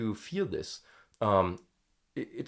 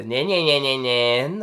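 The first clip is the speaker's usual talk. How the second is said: vocal fry nahs; 8 dB louder than usual. Shown as a demonstration. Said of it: "without doing the".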